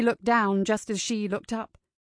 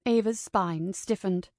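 ordinary delivery, nothing marked

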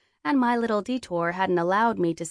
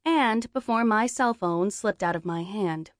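plain reading voice

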